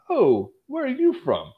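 high-pitched, slow mock curiosity; hammed-up retold question; imitated nosy guy. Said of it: "He's like".

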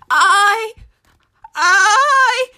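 frightened halting stutter; can't get the words out. A narrator's reading. Scared pig trying to confess to Duck, stuttering. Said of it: "I stutters".

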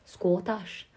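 quick Scottish-accent impression. Shown as a demonstration. Co-host trying out the accent her friend dislikes, Scottish accent. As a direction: try the word in a Scottish accent. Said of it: "not a lover of is Scottish".

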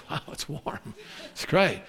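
hushed contented sigh. Acted. Israelites pleased by fire.

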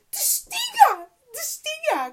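high, loud exasperated cry; exasperated outcry. Real, not acted. Ranting at sensitive viewers, high and exasperated. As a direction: throw your hands up in the words. Exasperated rant about joking.

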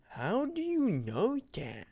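high, drawn-out mocking imitation; jerky girl's challenge. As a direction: imitate a bratty girl, stretched out and high. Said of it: "She kinda did say it like that".

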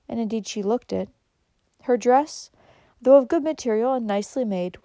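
ordinary delivery, nothing marked

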